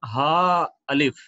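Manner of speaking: हा अलिफ गले से ज़ोर देकर धीरे; hard throaty ha. Shown as a demonstration. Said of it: "एक दफा फिर इसको पढ़ते हैं". (सख्त हा गले से)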